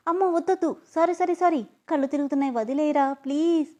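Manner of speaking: high, frightened pleading; rushed, high sorry sorry sorry; panicked begging. Acted. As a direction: beg him not to drop you. Held over edge, begging.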